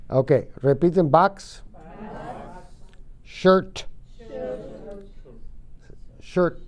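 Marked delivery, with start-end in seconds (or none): 3.2-3.9 "Shirt" with a crisp, separate final "t"; clearly released T. Shown as a demonstration. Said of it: "si no se pronuncia la T". 6.2-6.6 "Shirt" ending in a distinct "t" burst; clearly released T. Shown as a demonstration. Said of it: "si no se pronuncia la T".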